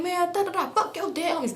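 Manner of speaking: high-pitched retold taunt; mock combative insult. Girl trading gang insults with him, mock combative.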